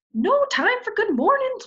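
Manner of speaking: high, loud hurried warning; urgent warning. Acted. Hen warns the prairie dog, no time to waste. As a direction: cut him off in alarm. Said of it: "warned Mary".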